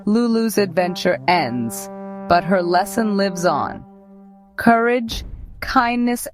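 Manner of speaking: plain reading voice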